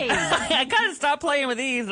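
ordinary talk, nothing marked

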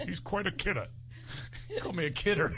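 mock comic voice. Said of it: "He goes".

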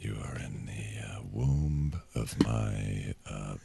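calm, low captain reassuring passengers; steady in-control airline captain; soothing pilot voice. Pretend. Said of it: "it feels like you're in control".

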